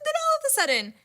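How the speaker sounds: very high, squealed build-up; high giddy squeal; giddy excitement. Real, not acted. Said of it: "I'm so excited just thinking about this".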